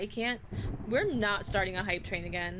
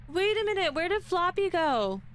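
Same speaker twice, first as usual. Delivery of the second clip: sudden alarm; 7 semitones higher, 4 dB louder than usual. Real, not acted. Streamer notices avatar missing.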